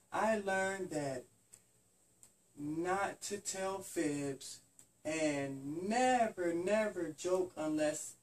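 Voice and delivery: very slow, word by word; slow earnest. Acted. Little bunny telling his lesson very slowly and earnestly. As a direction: say each word slowly and carefully.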